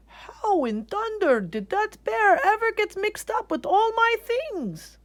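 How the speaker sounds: very high, slow puzzled man; baffled, drawing it out; wondering artist. Acted. Artist puzzling over the bear in his laundry. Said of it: "the artist wondered".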